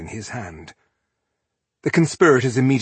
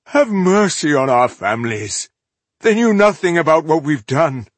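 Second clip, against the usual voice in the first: raised, loud begging; whining plea; 7 semitones higher, 7 dB louder than usual. A narrator's reading. Rebel begging for his family's lives, whining. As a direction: plead for your family in a high whine. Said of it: "Zedric was the first to whine".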